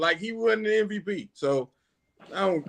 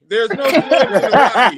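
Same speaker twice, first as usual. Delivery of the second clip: laughing; 6 semitones higher, 9 dB louder than usual. Real, not acted. Laughs at documentary claim.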